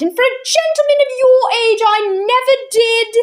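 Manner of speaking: put-on high, indignant old landlady's voice; shocked and huffy, tutting at her tenant; scandalised old landlady. Miss Persimmon scolding Mister Wig over tea in the air, indignant.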